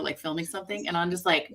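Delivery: ordinary talk, nothing marked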